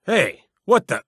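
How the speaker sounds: high, sudden startled yelp. Acted. Guard hit by the player's magic, startled yelp. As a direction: caught off guard mid-sentence.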